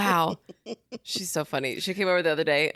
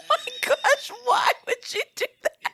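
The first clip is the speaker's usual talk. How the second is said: laughing in shock. Real, not acted. Cohost reacting to shocking story.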